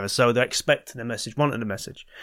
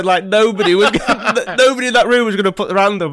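laughing; 9 semitones higher, 7 dB louder than usual. Real, not acted. Laughing while telling the scene.

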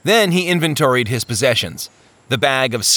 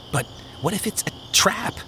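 higher, softer worried question; fearful Faintheart; 8 semitones higher, 7 dB quieter than usual. Acted. Faintheart warns his brothers, higher and softly worried. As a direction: fret that it's a trap.

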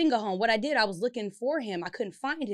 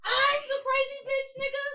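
outraged shout. Retold or imitated. To her boyfriend after he calls her crazy, an outraged high shout. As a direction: yell it back, stunned and indignant.

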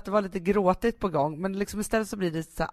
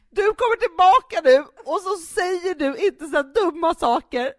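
high, loud hurt-mother plea, half laughing; guilt-trip the child like Mom would; sounding like our mother; 10 semitones higher, 7 dB louder than usual. Retold or imitated. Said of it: "jag är Stina Stjernberg, vår mamma".

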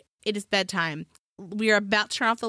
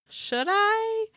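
high, rising musing question; high singsong self-questioning; tempted wondering; 12 semitones higher than usual. Retold or imitated. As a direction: pitch it way up, toying with the idea. Tempted by camera detector.

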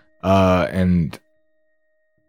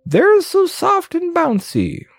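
Goblin King; 10 semitones higher than usual. Acted. Voices excited Goblin King.

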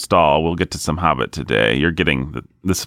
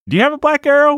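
high squeaky voice; 4 dB louder, faster than usual. Acted. Sudden high joking question.

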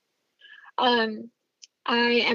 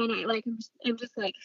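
normal talking voice

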